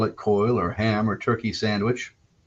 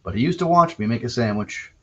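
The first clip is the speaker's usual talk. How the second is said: normal talking voice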